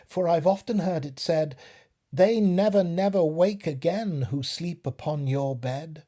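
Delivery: a small voice refusing firmly; little fly. Acted.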